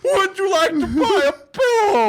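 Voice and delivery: hammed-up pillow salesman; Chris Farley character voice. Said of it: "Like a Chris Farley character".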